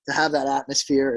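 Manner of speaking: normal talking voice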